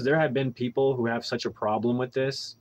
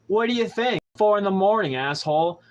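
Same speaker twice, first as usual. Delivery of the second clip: irritated retort; 7 semitones higher, 4 dB louder than usual. Retold or imitated. Snapping back at the cop.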